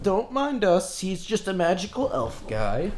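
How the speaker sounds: lightly voiced gnome brushing it off. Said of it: "and he's like".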